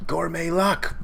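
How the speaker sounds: high-pitched anime move announcement; acted power-up call. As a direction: cry out the technique name dramatically.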